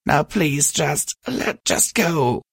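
high, halting, raspy plea; hoarsely beg, stumbling over the words. A narrator's reading. Crying mare begs her friend to go, hoarse. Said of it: "her voice hoarse and raspy".

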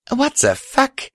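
very high, slow disbelief; high, drawn-out astonished curse; shocked outburst. Acted. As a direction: gape at a monster in high-voiced shock. Heroine shocked by monster appearing.

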